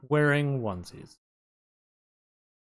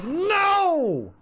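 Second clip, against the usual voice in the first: mock yell; 4 semitones higher than usual. Host yelling at Elisa for pulling off the shoe, mock yell. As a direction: shout the warning, then trail off. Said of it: "and I'm like".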